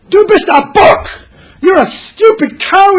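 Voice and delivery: retold shout. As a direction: bellow the insult like a furious rebbe. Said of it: "he hears the Rogatchover shouting at the guy".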